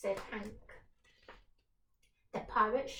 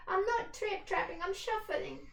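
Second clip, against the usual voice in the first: very small voice; 9 semitones higher, 4 dB louder than usual. Acted. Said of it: "said the Troll in a very small voice".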